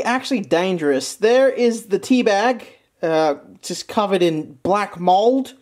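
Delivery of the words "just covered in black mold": ordinary talk, nothing marked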